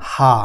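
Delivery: "হা" as German H, a long open "haa"; German letter name "হা", drawn-out ah. Shown as a demonstration. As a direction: say "হা" as one clear syllable, haa. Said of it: "এইচের উচ্চারণ আমরা কি করছি".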